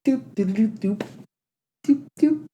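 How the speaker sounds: high, eerie sing-song doops; creepy doop sounds. Retold or imitated. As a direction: make it sound unsettling. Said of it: "it's really creepy".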